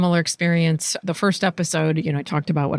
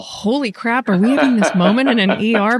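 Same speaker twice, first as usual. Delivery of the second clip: higher, louder incredulous outburst; amazed; 5 semitones higher, 4 dB louder than usual. Retold or imitated. Stunned son reacting to dad's confession in the ER. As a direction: caught off guard, half laughing.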